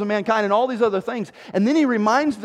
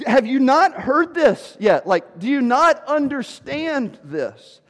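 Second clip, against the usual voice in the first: slow, higher, emphatic disbelief; incredulous Paul; 6 semitones higher, 3 dB louder, slower than usual. Retold or imitated. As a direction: press each word as if astonished. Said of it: "And that's, he's like".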